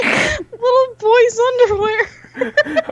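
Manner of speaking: high, slow, laughing words; giggling. Real, not acted. Starting the podcast topic, laughing. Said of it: "let's talk about".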